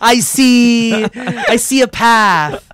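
slow, drawn-out prophecy; psychic voice. Pretend. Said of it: "You sound like a, uh, a psychic".

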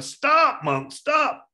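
high, loud acted yell. Murderer chasing the Buddha and failing to catch up, yelling. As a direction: yell after someone you can't catch. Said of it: "he kept yelling".

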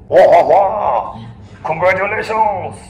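booming, high-pitched mock French accent; hammed-up French cheer, loud and stretched out. French show up at end-of-war party, congratulating Americans in French accent. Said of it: "French accent".

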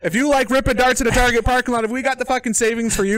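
put-on high, loud Canadian ad-pitch accent. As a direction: sell it like a Canadian car-lot ad. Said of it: "with my Canadian voice the whole time".

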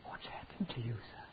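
whisper. Retold or imitated. Sorrowful rebuke to the organizer.

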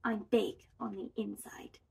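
soft storybook whisper; hushed little secret. A narrator's reading. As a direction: confide it very softly. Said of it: "Little Bob whispered".

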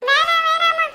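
loud, panicked plea; frightened kid yelling no; scared begging. Acted. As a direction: beg off the threat in a loud panic. Kid begs against threatened beating.

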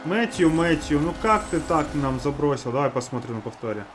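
ordinary delivery

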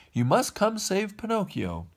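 high-pitched urgent plea; calling out. A narrator's reading. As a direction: call for help in a high voice. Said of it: "he called".

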